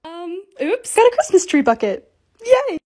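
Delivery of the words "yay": excited cheer. Real, not acted. Cheering at a bucket.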